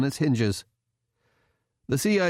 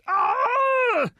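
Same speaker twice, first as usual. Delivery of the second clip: high-pitched agonized yell; sudden high scream; scream of pain; 3 dB louder than usual. A narrator's reading. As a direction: cry out as if struck hard. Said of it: "he screamed".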